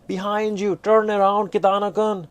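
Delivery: high, loud warning cry; urgent warning shout. Acted. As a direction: yell the warning to him. Ryoko warning Kitano.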